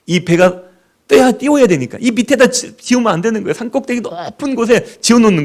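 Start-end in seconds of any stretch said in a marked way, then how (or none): none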